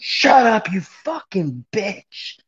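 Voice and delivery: high-pitched imitated screech that drops in volume; mock scream. Imitating a woman blowing up at another in chat, mock scream. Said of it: "Laura Lee was fucking screaming at Mary".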